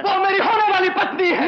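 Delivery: loud, high, outraged retort; furious shout. Acted. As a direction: roar in defence of the fiancée. Quarrel, defends fiancée.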